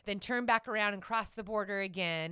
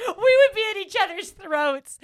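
laughing, much higher and louder; 11 semitones higher, 12 dB louder than usual. Real, not acted. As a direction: squeal it through laughter. Still laughing at the joke.

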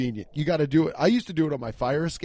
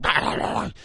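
harsh city dog bark; 6 semitones higher, faster than usual. Retold or imitated. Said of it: "City dog barks are like".

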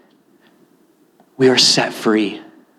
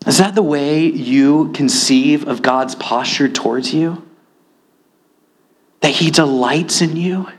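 ordinary delivery

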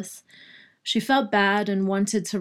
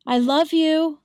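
calling out; 8 semitones higher, 5 dB louder than usual. A narrator's reading. Wife calling through door.

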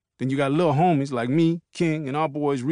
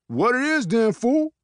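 challenge; 11 semitones higher than usual. Acted. Peanut squaring up to King.

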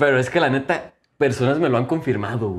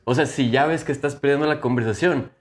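ordinary delivery

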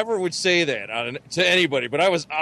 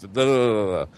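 ordinary delivery

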